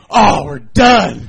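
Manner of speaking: loud, slow wail of defeat; dramatic despair. Retold or imitated. Scout kids hearing their skit is taken, loud slow wail. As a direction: drag it out like all is lost.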